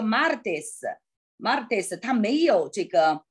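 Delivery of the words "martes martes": martes said slowly, clearly, twice; slow example word. Shown as a demonstration. Teacher slowly pronouncing martes as an example. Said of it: "比如martes".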